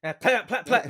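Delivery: mock gunfire pops with the lips; gunshot noises. Telling of being shot at, mouthing gunshots. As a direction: spit out plat plat like shots.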